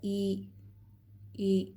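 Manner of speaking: ई said going down in pitch; falling ई. Shown as a demonstration. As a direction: let ई drop downward. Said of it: "इसका sound नीचे की तरफ जा रहा है". (नीचे जाती आवाज़ में ई)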